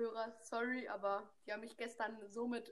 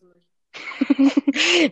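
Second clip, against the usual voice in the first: slow, loud, stretched out; drawn-out loud; 4 semitones higher, 19 dB louder than usual. Real, not acted. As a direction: drag it out loudly.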